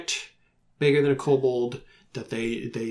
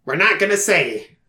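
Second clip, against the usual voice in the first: louder, higher kobold refusal; kobold spokesman; 5 semitones higher, 8 dB louder than usual. Acted. Kobolds turning back after whispering, loud announcement. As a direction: announce it firmly for the whole huddle. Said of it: "and they say".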